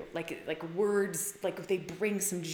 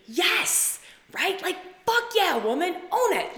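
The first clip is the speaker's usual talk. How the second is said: high, loud, fired-up cheering; excited cheer; 10 semitones higher, 6 dB louder, slower than usual. Real, not acted. Host cheering a viewer who called sex magical, high and loud. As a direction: hype her up like a cheerleader.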